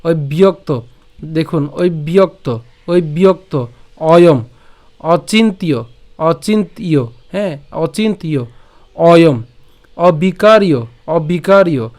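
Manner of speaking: each word set apart, from "ওই বিয়ক্ত" to "অবিকারীয়"; teacher's word-by-word model of "ওই বিয়ক্ত, অয়ম, অচিন্তীয়"; broken-down recitation. Shown as a demonstration. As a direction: pronounce অচিন্তীয় and অবিকারীয় deliberately, pausing between words. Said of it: "এই অংশটুকু ভেঙে বলছি".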